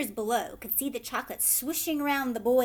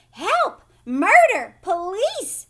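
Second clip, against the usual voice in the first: very loud high screams; screaming; 8 semitones higher, 14 dB louder, slower than usual. A narrator's reading. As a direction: scream hysterically. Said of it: "screamed Missus Gloop".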